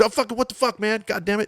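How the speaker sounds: high-pitched mock rant; imitated yelling. Jeff cursing at him over the phone, high mock yell. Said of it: "just yelling at me like Jeff does".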